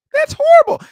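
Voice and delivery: squealed very high and loud; mock shocked girl. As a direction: gasp it like a horrified girlfriend. Mimicking girlfriend's shock.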